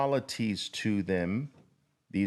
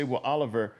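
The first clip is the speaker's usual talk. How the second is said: a hint of a sceptical student; doubtful trader. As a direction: ask it like a puzzled trader, lightly voiced. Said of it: "some traders say".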